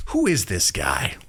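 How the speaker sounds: slow, musing question to himself; musing as Strahd. Retold or imitated. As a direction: ponder it slowly under the breath. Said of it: "I'm trying to be like".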